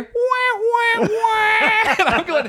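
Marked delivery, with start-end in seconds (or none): 0.0-1.4 very high mock wah wah cry; mock wailing. Comic imitating the deflating entrance, high mock wah. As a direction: wail like a sad trombone. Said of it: "you actually hear".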